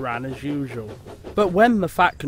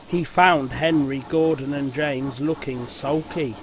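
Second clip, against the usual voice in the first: ordinary delivery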